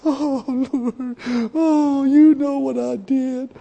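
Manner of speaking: mock whining prayer, slow; mock whiner. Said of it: "shut up with the whining".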